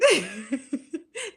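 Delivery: short bright laugh rising. Real, not acted. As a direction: laugh at your own joke. Laughs after joking about likes.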